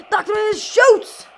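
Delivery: loud, high, stretched shot call; excited shout. Real, not acted. Gamer calling Doctor Wayne's shot, excited shout.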